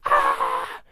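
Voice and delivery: loud, high mock scream; answering scream. Grinch screaming back at Cindy, loud mock scream. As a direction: scream back in comic alarm. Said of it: "And the Grinch is like".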